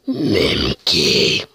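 very low, slow monster growl; Nightmare Freddy voice. Acted. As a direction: drag it out deep and slow like an animatronic. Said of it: "Nightmare Freddy voice".

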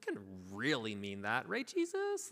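high-pitched, hedging mock excuse; justifying. Believer softens Jesus's hard saying, acted wheedling justification. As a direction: talk your way nervously round it, acting. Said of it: "I wanna justify it to be like".

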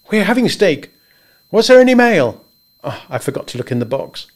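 a bright house cook; Lee's voice. A narrator's reading. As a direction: announce a craving cheerfully. Lee speaking.

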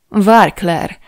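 urgent call after Claire; calling after her. A narrator's reading. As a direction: call her back before she opens the door. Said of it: "szólt utánam Jamie".

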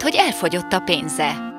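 ordinary delivery, nothing marked

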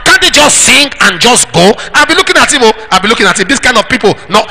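loud, driving pulpit tirade; full-force preaching. Real, not acted. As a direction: scold the congregation with mocking quoted commands.

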